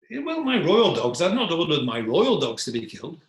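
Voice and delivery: high, loud royal protest; defensive king. Acted. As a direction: protest as if it were obvious, pitch leaping up. King defends his royal dogs.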